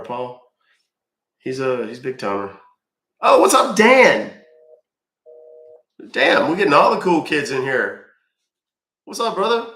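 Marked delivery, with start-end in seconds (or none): 3.2-9.7 excited greeting. Real, not acted. Greeting a friend joining chat.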